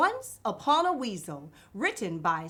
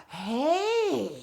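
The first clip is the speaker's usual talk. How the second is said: gasp then hey; 4 semitones higher than usual. Acted. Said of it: "I'm Miss Vocab".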